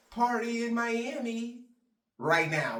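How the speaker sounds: high, loud, drawn-out hype call; lightly mock an influencer's party shout. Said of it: "just being like".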